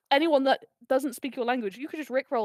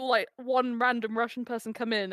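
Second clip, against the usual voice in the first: ordinary delivery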